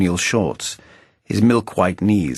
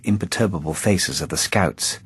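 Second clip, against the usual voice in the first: ordinary delivery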